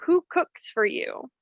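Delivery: slow imitated owl call, starting high and falling; barred owl call. Guide showing how the barred owl call sounds, imitated hoot. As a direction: mimic a barred owl hooting in words. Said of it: "barred owl call are said to sound like".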